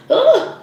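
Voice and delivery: high, loud overwhelmed ugh; sudden loud high groan. Real, not acted. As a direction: let the energy knock it out of you. Overwhelmed by strong energy.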